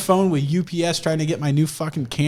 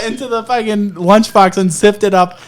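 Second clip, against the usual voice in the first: ordinary delivery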